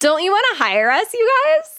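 high wheedling sales voice; silly high put-on plea; demo character voice. Shown as a demonstration. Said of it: "we do lots of different voices".

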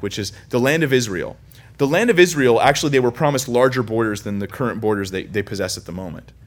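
ordinary delivery

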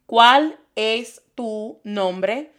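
very slow "¿Cuál es tu nombre?" word by word; slow teaching demo. Shown as a demonstration. Teacher slows the question for the class. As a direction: draw out "¿Cuál es tu nombre?" for learners. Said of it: "I hear this question instead".

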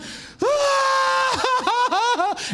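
long, high mimicked screaming wail; both screaming. Husband mimicking wife and son lying in bed, screaming. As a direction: wail on like two people screaming. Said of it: "both lying in bed just screaming".

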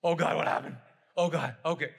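loud panicked parent, re-enacted; acted-out freak-out, loud. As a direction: blurt it out in mock panic. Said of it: "I'm freaking out".

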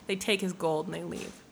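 ordinary delivery

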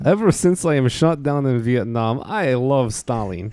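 hammed-up Russian accent; comic fake Russian voice; John McCain. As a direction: play a mock Soviet in broken English. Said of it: "Can you do Soviet John McCain".

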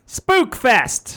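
screamed. Pretend. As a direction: yell it into the mic like a hype man. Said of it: "Don't scream into this new microphone".

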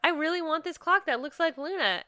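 high, gushing over something adorable; gushing excitement. Retold or imitated. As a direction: squeal over the cute clock. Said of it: "she's just like".

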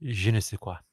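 lightly put-on French seduction voice; sexy French accent. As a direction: fake French, slow and sultry. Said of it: "French, sexy, fake voice".

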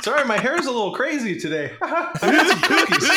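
high, laughing granny voice; a sweet grandma fussing with laughter; sweet old lady voice. Acted. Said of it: "sweet old lady voice coming out".

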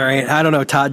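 normal talking voice

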